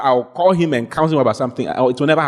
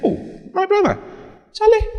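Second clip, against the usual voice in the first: pleading call. Retold or imitated. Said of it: "I said".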